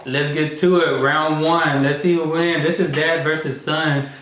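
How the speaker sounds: ordinary talk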